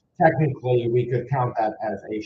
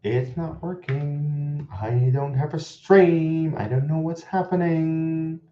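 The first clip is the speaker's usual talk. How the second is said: slow, higher whine with held endings; drawn-out lament; 5 semitones higher, slower than usual. Real, not acted. Streamer telling viewers the stream died, slow helpless whine. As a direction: stretch each phrase into a helpless moan.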